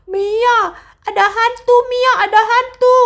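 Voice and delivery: panicked warning. Acted. Yuto shouts warning to Mia.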